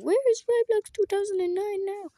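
high questioning fan; lightly voiced confused fan; puzzled fan voice. Fans asking where he went.

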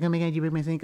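lightly voiced accusing woman; upset woman. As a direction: imitate her dismay, lightly hammed-up. Voicing woman upset about sink.

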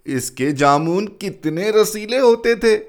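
a touch of a clerk's relish. A narrator's reading. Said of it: "दूसरा clerk बोला".

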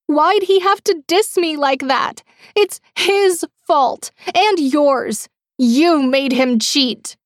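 very high, slow, loud accusing wail; delusional accusation. A narrator's reading. Captor blames Brene for her boyfriend cheating, high unhinged accusation.

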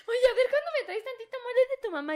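high fake-sweet voice, laughing through it; mock sweet girlfriend.

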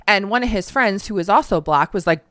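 ordinary talk

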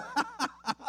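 high scoffing cackle from the mourners; mocking laugh. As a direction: imitate the mourners laughing him off. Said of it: "they start laughing".

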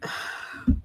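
unvoiced shaky sigh holding back tears. Real, not acted. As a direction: breathe out shakily, fighting tears. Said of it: "I'm trying not to cry".

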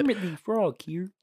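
spot-on Kermit voice; Kermit impression. Shown as a demonstration. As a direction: nail Kermit the Frog's greeting. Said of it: "that's so fucking spot on".

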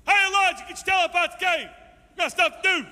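loud, high, hollering call out of the cave; loud mock-impatient God yelling into the cave; booming shout. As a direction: holler at him to get moving. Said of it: "if I was God, I'd say".

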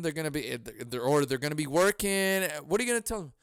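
ordinary talk, nothing marked

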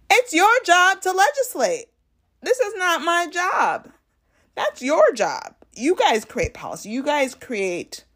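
high, emphatic lecturing; imitating a judge putting a senator in his place; pointed retort. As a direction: set him straight, loudly and high. Acting Ketanji's retort to senator.

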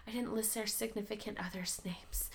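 whispered aside. Retold or imitated. Retelling her sister's text in a whisper. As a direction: breathe it with no voice.

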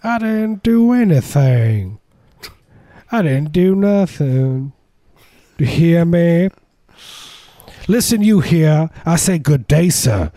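loud, high, hammed-up Southern drawl; slow, indignant Southern gentleman's accent. Mock Southerner dismissing a stranger, high loud Southern drawl. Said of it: "what they say to you in the South".

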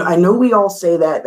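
normal talking voice

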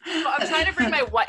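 laughing loud through the words; cracking up, barely getting it out; hysterical laugh. Real, not acted. Laughing loudly mid-sentence.

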